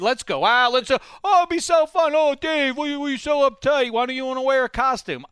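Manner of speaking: high, coaxing party voice; pushy party friend. Retold or imitated. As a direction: whine at him to come along. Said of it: "annually I get invited".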